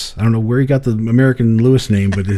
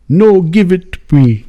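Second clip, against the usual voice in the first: put-on higher, louder, slow African accent; imitating an African accent; 7 semitones higher, 7 dB louder, slower than usual. Zambian man asking for the Satanic Bible, eager African accent. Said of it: "But if I do this, then it's him".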